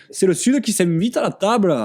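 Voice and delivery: high, mocking southern French accent; badly imitated southern accent. Diners at the next table in Venice, badly mimicking a southern accent. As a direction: put on a hammed-up accent of the South. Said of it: "très mal imité".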